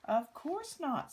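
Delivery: friendly moose answering with a smile; smiling moose. A narrator's reading. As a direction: beam it out as the kindly moose. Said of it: "smiled Thidwick".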